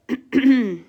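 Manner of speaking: a quick rough throat clear. Real, not acted. Sick vlogger, clearing a sore throat.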